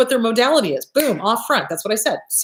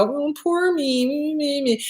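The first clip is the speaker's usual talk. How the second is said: drawn-out, higher, whiny poor me; mock whiny self-pity; 5 semitones higher, 4 dB quieter, slower than usual. Therapist mocks a client who just complains on the couch, slow whiny voice. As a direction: lay the self-pity on thick.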